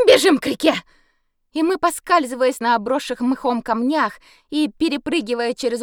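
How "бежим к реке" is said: loud, sharp urgent bark; barked command. Acted. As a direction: bark the order to run. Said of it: "рявкнула".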